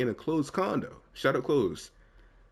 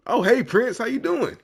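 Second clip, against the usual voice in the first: high, bright eager hello; eager fan greeting; 12 semitones higher, 7 dB louder than usual. Pretend. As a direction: gush over a celebrity. Imitates fans greeting a star.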